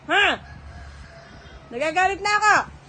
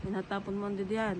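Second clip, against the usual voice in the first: hushed aside; 10 semitones lower, 13 dB quieter than usual. Real, not acted. Parent coaching child in secret.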